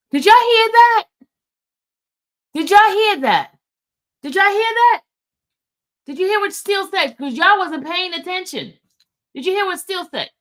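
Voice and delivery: high, urgent questioning, starting loud; excited disbelief. Real, not acted. Host making sure listeners caught what Steele said, excited and high. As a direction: pitch it way up, demand that they heard it.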